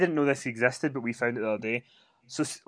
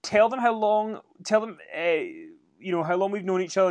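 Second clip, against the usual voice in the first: higher, mock interviewer voice; mocking Lana; 6 semitones higher, 4 dB louder than usual. [name] mocking Lana's interview with Bobby, higher mock voice. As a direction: ham up a gushing host. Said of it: "there's one with her and Bobby Lashley where she's like".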